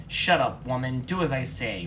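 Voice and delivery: loud barked order; angry loud command; roar. Acted. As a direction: roar them into silence. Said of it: "roared Garfield".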